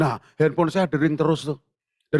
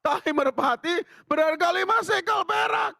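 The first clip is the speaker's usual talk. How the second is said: high, slow, lifted Bible reading voice; scripture reading; 14 semitones higher, slower than usual. Acted. Pastor reading the verse to the congregation, high lifted reading.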